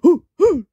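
high, loud imitated hoots; two mock owl hoots; owl's hoot. A narrator's reading. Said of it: "said the wise old owl".

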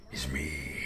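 dropping low and menacing; a villain confessing in a deep voice; sinister reveal. Acted. Dad revealing to himself he's the monster, low and menacing.

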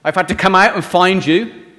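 loud scolding shout; scream and shout. Pretend. Angry shepherd yelling at the lost sheep, loud. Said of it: "doesn't scream and shout at him".

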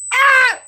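short, high, loud scream. Acted. Fed up with the alarm, short scream. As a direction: burst out with a high yell.

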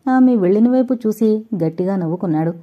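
plain reading voice

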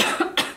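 a real cough mid-sentence; coughing fit. Real, not acted. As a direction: cough once, hard. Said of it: "I'm sorry that I'm coughing a lot".

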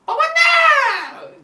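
loud, high-pitched yell for grandma; bağırarak seslenme. Retold or imitated. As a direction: call out to grandma at full volume, very high. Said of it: "diye bağırdım". (avazı çıktığı kadar bağırma)

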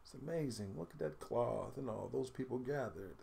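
usual speaking voice

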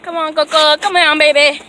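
loud, high coaxing call. Real, not acted. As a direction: call the dog sweetly but loud. Calling dog.

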